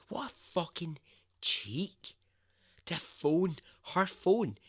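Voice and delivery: real indignation, pitched high; indignant. Real, not acted. Host appalled at the attacker phoning his victim, indignant. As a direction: exclaim it, punched high and outraged.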